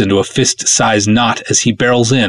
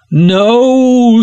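high, loud anguished scream; villain scream; 5 dB louder than usual. A narrator's reading. Hawas screams as the princess fades away. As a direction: scream as your love vanishes. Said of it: "screams Ardoth Hawas".

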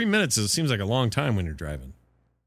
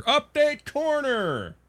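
high, slow, drawn-out segment announcement; announcer voice; much slower than usual. Acted. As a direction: belt it like a show jingle.

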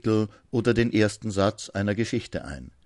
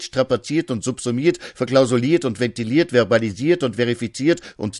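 building, louder and higher declamation; hammy stage actor; 4 semitones higher, 3 dB louder than usual. Acted. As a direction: rattle off the verbs like a grand stage ham. Declaimed escalating list of verbs.

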